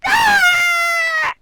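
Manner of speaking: long, high, loud imitated cry; mock toddler wail. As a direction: wail like a little kid bursting out. Nephew wailing as she is seen.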